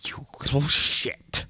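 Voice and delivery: ordinary delivery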